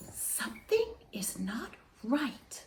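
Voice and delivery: hushed, breathless whisper; fearful whisper. A narrator's reading. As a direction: whisper it, afraid of a disaster. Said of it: "afraid of a disaster".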